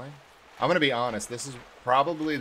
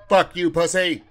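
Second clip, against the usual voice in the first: shouting; 9 semitones higher, 13 dB louder than usual. Real, not acted. Player yells at the monster.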